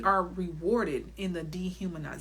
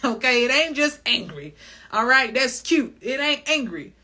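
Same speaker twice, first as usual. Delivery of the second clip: mock pissed; 5 semitones higher, 7 dB louder than usual. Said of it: "It's pissed".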